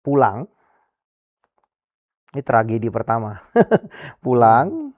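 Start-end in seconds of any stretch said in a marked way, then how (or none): 3.4-4.2 short high laugh, almost disbelieving; rueful laugh. Real, not acted. Man telling the host about his tragedy, a short rueful laugh. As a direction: let a brief laugh slip out before going on.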